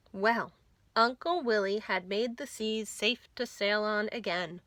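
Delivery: usual speaking voice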